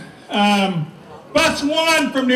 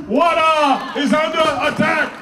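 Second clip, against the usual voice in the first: ordinary delivery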